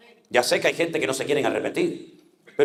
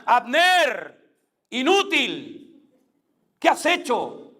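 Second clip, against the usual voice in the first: high, drawn-out rebuking shout; calling from afar; 7 semitones higher, much slower than usual. Acted. David calling down to Abner from the mountain, calling out. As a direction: call it down from a mountaintop, stretching the words. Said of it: "le dijo desde lo alto de la montaña".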